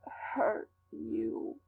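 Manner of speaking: breaking into a whispered sob; crying. Acted. Frightened man begs you not to stay, crying softly. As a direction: choke the words out through tears. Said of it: "He started to cry".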